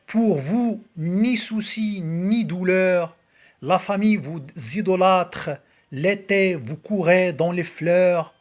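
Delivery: slow, projected poetry reading; poem recitation. Acted. Reading Victor Hugo's poem to video viewers, slow declaimed recitation.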